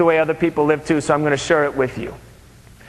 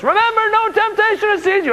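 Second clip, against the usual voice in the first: much higher, sunny preachy chirp; cheerful guard quoting scripture. Acted. Security guard Joe quoting a verse to Mathewson, much higher and chirpy. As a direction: beam a Bible verse at him. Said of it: "he starts quoting a verse".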